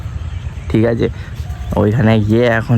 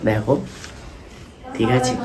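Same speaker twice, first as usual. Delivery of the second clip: hushed so mother sleeps; 2 semitones lower, 8 dB quieter than usual. Real, not acted. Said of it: "মা কিন্তু ঘুমাচ্ছে এখন".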